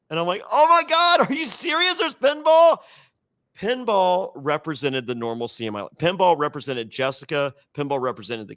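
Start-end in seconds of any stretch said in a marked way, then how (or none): 0.5-2.8 high, loud mock delight; over-the-top thrilled squeal; excited. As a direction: gush like a kid who just found pinball. Excited outburst over pinball.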